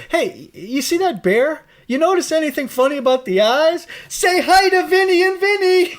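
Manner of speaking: high playful voice quoting a film; character voice. Retold or imitated. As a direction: do the movie line in a high voice. Quoting bear scene from movie.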